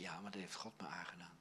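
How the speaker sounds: low, near-whispered admission; hushed inner thought. Retold or imitated. Client privately admits blaming God, hushed and low. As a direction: let it sink to a murmured thought.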